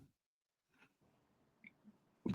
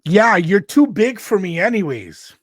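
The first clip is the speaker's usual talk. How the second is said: snide; 6 semitones higher, 8 dB louder than usual. Retold or imitated. Date's cutting remark.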